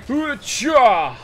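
high, loud yell on the hit; explosive battle cry. Real, not acted. Gamer striking the ball at the net, explosive yell. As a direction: shout it like a karate strike. Yells while hitting the ball.

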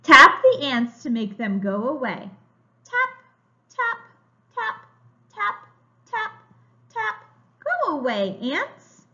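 slow, bright storybook reading; reading to children. A narrator's reading. Teacher reading a picture book to young children, slow and bright. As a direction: read it like a picture book for little kids.

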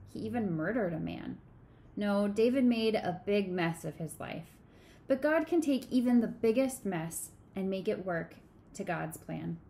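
ordinary delivery

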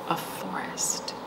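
slow, soft whispered ending; hushed whisper. Acted. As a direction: let the last words fade into a whisper. Hushed ending of picture book.

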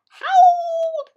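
long, loud howled word; drawn-out howl. A narrator's reading. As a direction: stretch the word into a coyote howl. Narrator acts out the howl.

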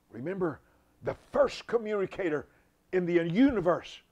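loud, high preacher's cry; preaching shout. Real, not acted. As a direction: thunder it out to the congregation. Preacher drives home a point.

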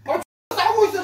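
yelling. Real, not acted. Shocked, yelling.

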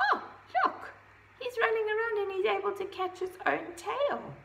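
storyteller marvelling to a little listener; excited. Acted. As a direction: share the wonder with the child. Excited aside to child listener.

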